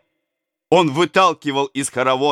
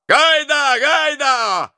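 high, loud battle cry; loud high war whoop; raider's shout; 3 dB louder, much slower than usual. A narrator's reading. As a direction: yell it like a charging rider. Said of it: "кричал он".

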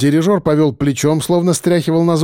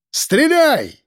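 sharp high command; shout; 11 semitones higher than usual. A narrator's reading. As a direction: yell the order urgently. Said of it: "крикнул я". (громкий крик)

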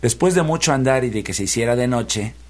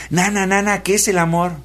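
put-on high eager child's question; curious child prince; 8 semitones higher than usual. A narrator's reading. Young prince asking his nanny what love is, high and eager. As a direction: ask like an innocent boy.

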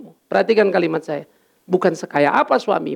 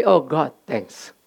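slow thankful sigh; 6 semitones lower, much slower than usual. Retold or imitated. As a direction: let it out slowly, grateful. Private grateful thought quoted. (syukur pelan dan lambat)

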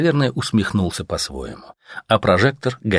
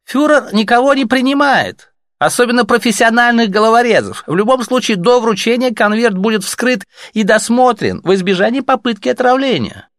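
higher, louder, officious and polite; a bureaucrat brushing off visitors at night; cool Reich officer; 5 dB louder than usual. Acted. Reich officer answering the messengers, officious.

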